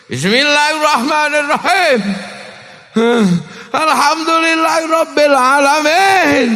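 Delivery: Umar's fierce recitation. Retold or imitated. Umar reciting the Quran, high forceful voice. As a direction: recite like a fearsome warrior. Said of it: "Sambil nangis".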